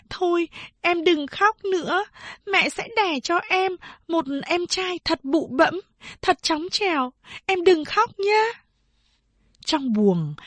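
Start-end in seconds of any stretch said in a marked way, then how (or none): 0.1-8.4 soothing big sister. A narrator's reading. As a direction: hush her gently, like a big sister. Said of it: "dỗ dành nó".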